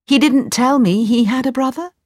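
high, puzzled mother's voice; doubtful. Acted. Mother challenges the man's claim, doubtful. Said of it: "Aladdin's mother frowned".

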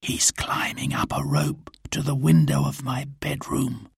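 hushed lookout's report; whisper. A narrator's reading. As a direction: whisper what you see below. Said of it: "whispered Robin".

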